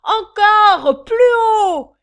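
hurlé très haut et fort; réclament la suite à grands cris; crié. Acted. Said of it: "les mâles de protester". (clameur très haute et forte)

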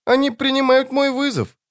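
put-on very high, childlike demon voice; Жемчуг. A narrator's reading. Demon reports on its foes to the wizard, child voice. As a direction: remark it as an eerie child creature. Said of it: "заметил Жемчуг".

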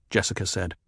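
ordinary delivery, nothing marked

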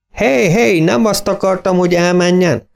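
high, pleading call after him; hey, don't go, call him back high; urgent calling out. Acted. Farmer calls after departing car.

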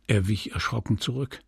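ordinary delivery

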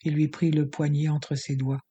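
plain reading voice

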